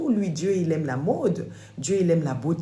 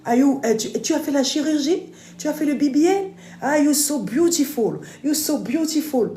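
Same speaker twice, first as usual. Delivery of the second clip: admiring American women; 11 semitones higher, 6 dB louder than usual. Retold or imitated. The American women gush over her looks, mock American gushing. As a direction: gush like a starstruck fan.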